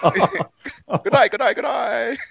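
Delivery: high-pitched mock Australian greeting; Australian accent. Joking with the Australian host, high mock Australian accent. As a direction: pile on a cartoon Aussie accent.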